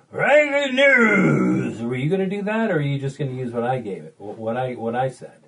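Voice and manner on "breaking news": announcer impression building to a high, loud shout; mock big-announcer bellow. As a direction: drag it out into a huge announcer yell. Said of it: "in your big loud voice".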